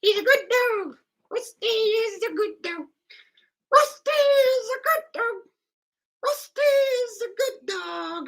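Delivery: very high, squeaky pet-praise voice; high, sing-song praise of a good dog; doggy baby talk. Pretend. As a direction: coo at the dog like a toddler.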